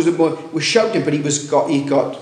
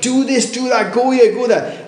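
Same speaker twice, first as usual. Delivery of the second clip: crowd shouting orders; 9 semitones higher than usual. Retold or imitated. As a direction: bark out the clashing orders like a noisy crowd. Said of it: "everyone shouted".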